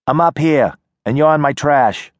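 put-on wary girl calling from a porch; tough city girl. Nadia voiced by narrator.

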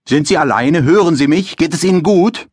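a hint of a loud, checking officer. A narrator's reading. Officer questions dazed resident.

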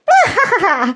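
a high wicked cackle; short evil cackle at the table; witch cackle. A narrator's reading. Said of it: "she cackled".

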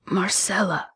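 soft, breathy hissed name; dying hiss. A narrator's reading. Dying man hissing the housekeeper's name, barely audible. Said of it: "the man hissed with his last breath".

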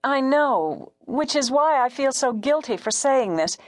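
high, tentative woman caller; timid caller pitched high; hesitant radio caller. A narrator's reading. Caller confesses guilt.

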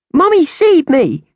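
put-on very high, loud, slow child's protest; child shouting. Angel insisting to her mother, very high loud child's shout. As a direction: stamp it out like a toddler. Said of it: "Angel shouted".

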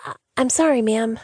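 high, stammering nervous apology; flustered apology. Acted. Sandra apologizing to her cold boss, high and nervous. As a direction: trip over the sorry, a little scared. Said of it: "Sandra said quickly".

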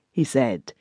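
plain reading voice

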